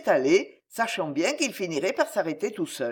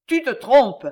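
higher, louder, certain; chilling assurance; 6 semitones higher, 7 dB louder, slower than usual. A narrator's reading. Léonore insisting to Concini, chilling certainty. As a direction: say it like a fact that frightens. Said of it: "avec une effrayante assurance".

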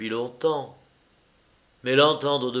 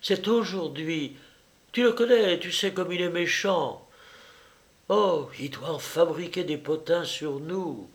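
nagging insistence, pitched up; insistent; 6 semitones higher than usual. A narrator's reading. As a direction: press on in a lighter, higher voice. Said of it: "insista Juliette".

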